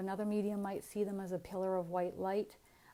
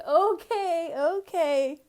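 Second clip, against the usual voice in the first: high, loud laughing "okay"; acted-out amused surrender; laughing concession; 10 semitones higher, 12 dB louder, much slower than usual. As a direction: give in, helpless with laughter. Acts out giving in, laughing.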